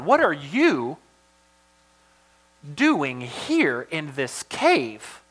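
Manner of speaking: very slow, "you" and "here" swooping up and down; stress on YOU and HERE. Shown as a demonstration. God confronting Elijah in this cave, stress on "you" and "here". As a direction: stretch out "you" and "here", pausing between. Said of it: "a big emphasis on you".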